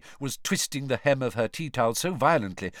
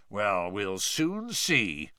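growled; much slower than usual. A narrator's reading. Diggory brushing off the children with a growl. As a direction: growl it, unimpressed. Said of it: "growled Mister Diggory".

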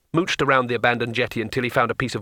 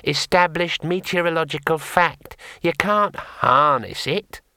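higher little imp voice, gently explaining; gentle imp; 6 semitones higher, slower than usual. Acted. Imp explaining lightning to the wizard, gentle. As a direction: patiently correct him like a tiny sage. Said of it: "it said gently".

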